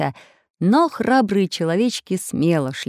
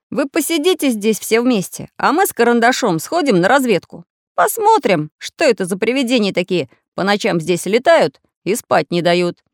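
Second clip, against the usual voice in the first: animated, higher, loud instructions; taking charge; 4 semitones higher, 5 dB louder than usual. A narrator's reading. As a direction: give orders to the group. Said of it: "распорядился он".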